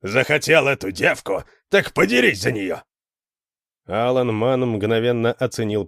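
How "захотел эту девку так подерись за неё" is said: brutish, half-roared challenge; roaring challenge. A narrator's reading. Hara dares Alan to fight, roaring. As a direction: throw down a rough challenge. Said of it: "проревел Хара".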